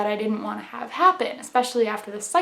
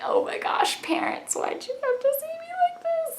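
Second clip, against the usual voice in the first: hushed, breathy mock embarrassment, rising high at the end; embarrassed whisper; 6 dB quieter than usual. Daughter whispering her shame at being seen crying by parents. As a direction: whisper it, mortified, squeaking up at the end.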